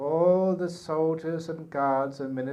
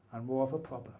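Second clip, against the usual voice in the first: normal talking voice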